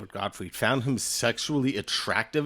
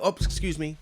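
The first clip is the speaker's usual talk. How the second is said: higher-pitched mock apology; polite squeezing past; 5 semitones higher than usual. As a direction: lightly play someone edging down a row. Acting out winner squeezing past seats.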